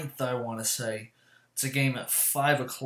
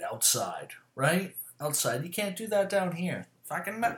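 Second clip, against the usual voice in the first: normal talking voice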